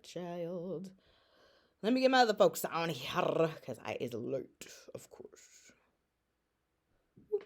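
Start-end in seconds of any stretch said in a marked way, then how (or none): 3.6-5.7 whispered aside. Real, not acted. Mumbled aside about being late.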